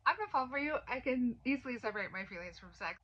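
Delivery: high, loud mock-breezy brush-off; sarcastic scoff. Retelling her brushing off his warning, sarcastic. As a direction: toss it off like it's nothing.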